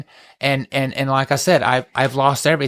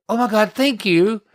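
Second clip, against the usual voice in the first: mock delighted thanks; 7 semitones higher than usual. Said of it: "I stood up and I said".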